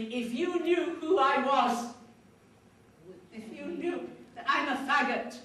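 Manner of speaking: retold anguish, lightly tearful; tearful confession. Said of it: "With those tears rolling down her face".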